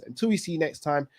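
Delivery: ordinary talk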